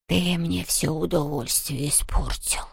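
slow, soft hurt ghost; sulky ghost. A narrator's reading. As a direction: sulk like a disappointed ghost. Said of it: "огорчился Хуга". (огорчённое привидение)

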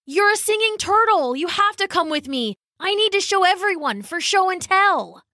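put-on high, insistent young Zane; excited pleading boy. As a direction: beg her eagerly to come along.

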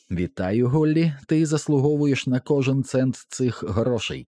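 lightly voiced congratulating stranger; голос із жарту. A narrator's reading. Тож голос промовляє. (трохи вищий голос привітання)

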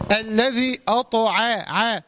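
slow "أطع", heavy ط and thin ع; deliberate recited "الذي أطع", only ط heavy; light letters kept clean. Shown as a demonstration. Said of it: "لابد من تخليص الحروف المرققة".

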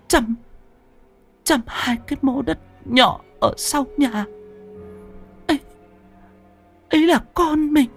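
high, halting, strained last words; dying effort. Acted. Dying wife confesses to husband, high halting strained voice. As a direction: force out each word with the last breath. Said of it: "dồn hết hơi tàn, gắng gượng".

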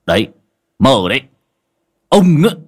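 loud sharp rebuke; scolding. Acted. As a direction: snap at him as you strike. Master scolding while hitting.

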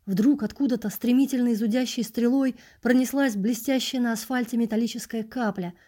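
usual speaking voice

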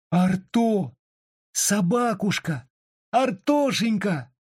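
high, slow, crying out for the dog; tearful calling. A narrator's reading. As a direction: plead with a sob in the voice. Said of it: "вторил ей плачущим голосом мальчик".

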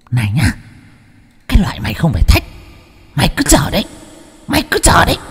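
high menacing ghost voice; spirit threatening. A narrator's reading. Spirit pointing at the husband, menacing high threat. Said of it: "cười khanh khách, chỉ tay".

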